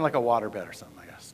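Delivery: a low unvoiced aside muttered under the breath; whispered aside. Real, not acted. Joking aside to the audience, whispered. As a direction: slip the joke in as a soft whisper.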